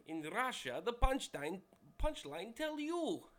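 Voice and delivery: loud, rising mock Russian accent; bad Russian accent. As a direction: deliver the punchline as a hammed-up Russian, stretching the end. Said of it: "excuse to do a bad Russian accent".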